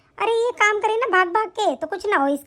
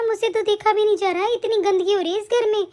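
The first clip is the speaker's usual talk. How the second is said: usual speaking voice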